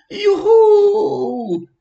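put-on very high, loud child's whoop of joy; joyful cheer. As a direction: cheer like a thrilled boy. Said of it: "rief Levi". (lauter, hoher Jubelruf)

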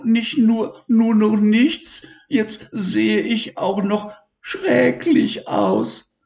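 high, slow and tearful; high whimpering little voice; despairing stammer. Acted. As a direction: stumble over words in despair. Elmar despairs, stuttering.

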